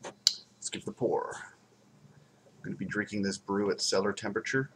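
usual speaking voice

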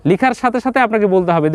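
ordinary delivery

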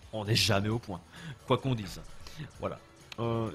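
ordinary delivery

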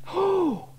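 sudden high loud gasp of wonder; startled by the spider; girl startled, crying out high; surprised cry. A narrator's reading. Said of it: "cried Sarah".

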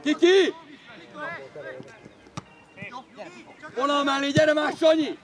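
loud sideline orders; urging shout. Real, not acted. Coach sends players along the line, urging shout. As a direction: yell them into position.

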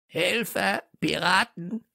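high-pitched parrot squawk. A narrator's reading. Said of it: "krächzte wieder jemand". (krächzender Papagei, hohe Stimme)

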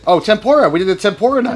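excited. Real, not acted. Excited recall of recipe.

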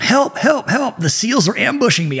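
high-pitched, panicked imitation; hammed-up victim calling out in alarm; mock cry for help.

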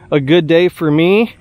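ordinary delivery

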